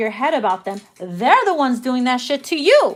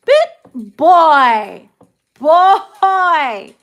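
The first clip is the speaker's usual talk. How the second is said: loud call-out; 4 semitones higher, 8 dB louder, much slower than usual. Real, not acted. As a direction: call him out loudly. Loud retort.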